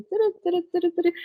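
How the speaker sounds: high imitated saw buzzing, turu turu; sawing noise. Friend retelling a horror film scene, high mock sawing sound. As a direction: mimic an electric knife sawing through a toe. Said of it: "é o negócio serrando".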